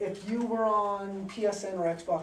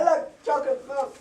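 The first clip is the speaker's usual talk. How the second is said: put-on teenage boy voice; 8 semitones higher, 8 dB louder than usual. Shown as a demonstration. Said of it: "a teenage boy talking".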